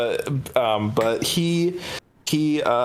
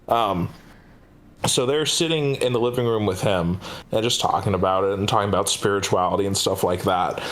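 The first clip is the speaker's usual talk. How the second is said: normal talking voice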